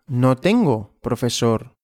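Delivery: normal talking voice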